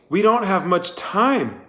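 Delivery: high, pressing urgency; urgent plea. A narrator's reading. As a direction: push him to act now. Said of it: "urged Catherine".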